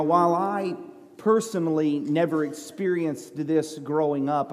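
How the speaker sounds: ordinary talk, nothing marked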